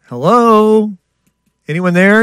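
mock hello into the void; mock calling out. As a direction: call into an empty room, teasing. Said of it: "mock calling into emptiness".

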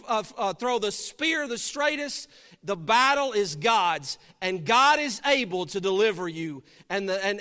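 loud, fervent preaching. Acted. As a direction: preach it with fire. Preaching at full force.

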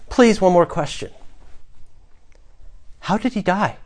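begging. Acted. Freed prisoner begs the guard for one answer, pleading softly. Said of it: "you have to beg the guard".

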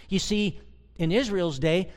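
usual speaking voice

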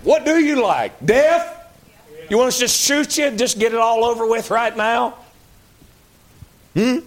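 sarcastic disbelief at people who can't be pleased; exasperated mock retort. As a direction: throw it back at them, fed up and sarcastic. Mock exasperated reply to complainers.

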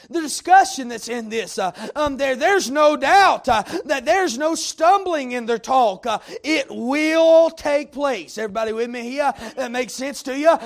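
rising, rhythmic pulpit cadence with punched words; preaching at full force. Acted. Preacher exhorting the congregation, full-force preaching. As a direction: preach it hard to the room.

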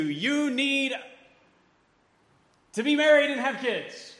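a nagging voice that commands you; worldly demand, yelled. Retold or imitated. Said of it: "The world may be screaming at you".

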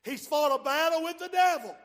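loud proclaiming; loud preaching. Real, not acted. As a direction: belt it to the back row. Preaching climax.

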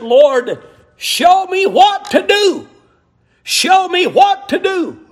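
praying in exasperation; pleading prayer. Retold or imitated. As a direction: cry out to the Lord, high and insistent. Acting out a desperate prayer.

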